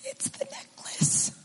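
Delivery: barely voiced through tears; choked whisper. Real, not acted. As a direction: whisper it, overcome. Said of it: "all I say is".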